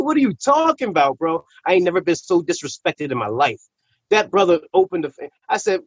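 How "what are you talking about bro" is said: indignant disbelief. Retold or imitated. Indignant reply to troopers.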